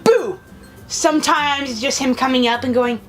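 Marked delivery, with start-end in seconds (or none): 0.0-0.4 sudden higher "boo"; mock jump-scare shout; imitated scare. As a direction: pop it out to startle. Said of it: "he'll scare me, like, go".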